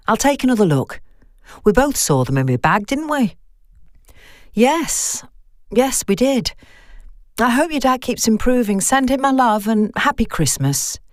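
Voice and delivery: nervous, rising worry on the phone; a hint of a frightened friend; lightly voiced Vicky. Vicky voiced, call winding down.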